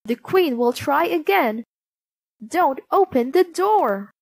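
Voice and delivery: high, emphatic little voices; warning dwarfs. A narrator's reading. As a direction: urge her firmly to take care. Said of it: "the dwarfs warned her".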